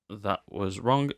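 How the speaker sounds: ordinary talk, nothing marked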